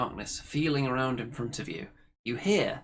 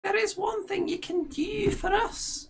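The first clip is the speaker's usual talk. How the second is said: much higher crone voice; high old hag approving. Acted. Ethel praises her sister's plan, high crone voice.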